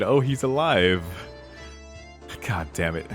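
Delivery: ordinary talk, nothing marked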